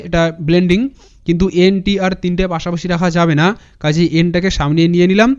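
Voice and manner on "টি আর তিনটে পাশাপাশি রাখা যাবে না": ordinary talk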